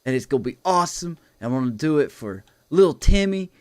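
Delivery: hyped wrestler promo voice, pushed up and loud; R-Truth promo. Retold or imitated. Friend retelling R-Truth's promo to Sean, hyped wrestler voice. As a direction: pump it up like a wrestler cutting a promo. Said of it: "he's like".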